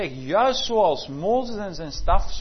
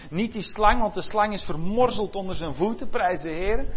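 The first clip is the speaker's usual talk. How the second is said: loud preaching; 5 dB louder than usual. Acted. Jesus quoted, preached loudly.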